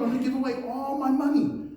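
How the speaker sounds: high, loud, fast eager vow; eager pledge. Acted. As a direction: announce it like a proud big giver. Voices a generous giver.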